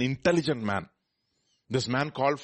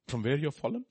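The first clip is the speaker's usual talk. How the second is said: ordinary talk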